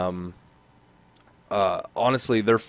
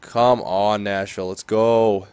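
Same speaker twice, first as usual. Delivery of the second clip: usual speaking voice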